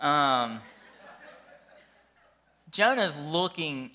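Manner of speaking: ordinary talk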